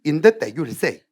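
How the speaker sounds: rushed English reading; hurried, careless recitation; too fast. Shown as a demonstration. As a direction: rattle the English off hastily. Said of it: "엄청 빨리 하라고".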